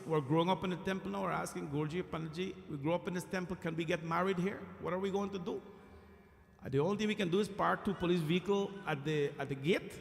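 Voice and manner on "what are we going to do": usual speaking voice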